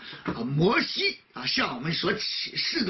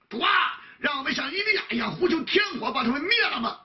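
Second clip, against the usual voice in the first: higher, fast, eager plea for fire; zealous disciples; 6 semitones higher, faster than usual. Retold or imitated. Disciples beg Jesus to call down fire, eager and fast. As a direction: urge it like hotheaded disciples.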